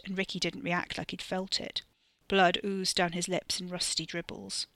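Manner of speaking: plain reading voice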